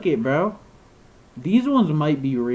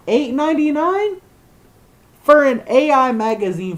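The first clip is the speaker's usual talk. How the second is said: outraged shriek; 11 semitones higher, 10 dB louder than usual. Real, not acted. Outraged at the magazine price.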